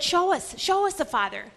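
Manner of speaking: Philip pleading high and loud; insistent plea from the disciple; eager demand. Retold or imitated. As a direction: beg to be shown, pressing hard. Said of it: "Philip said".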